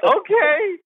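high startled yelp of a yes; sudden high-pitched nervous okay; squeaky okay. Retold or imitated. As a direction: jump at being called. Nervous reply to famous golfer.

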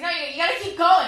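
mock-urgent encouragement; urgent push. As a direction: hurry him on, insistent. Retold urging, louder.

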